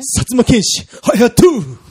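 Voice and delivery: hero name call. Pretend. Announcing a hero's name.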